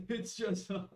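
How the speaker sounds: cracking up mid-sentence; laughing. Real, not acted. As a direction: try to talk through the giggles. Game master cracks up mid-sentence.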